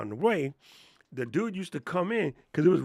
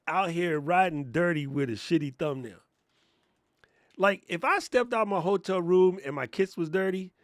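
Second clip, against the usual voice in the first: ordinary talk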